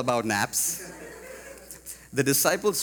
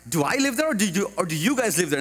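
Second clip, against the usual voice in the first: incredulous retort; 7 semitones higher, 4 dB louder, faster than usual. Retold or imitated. Retorting to church youth kids.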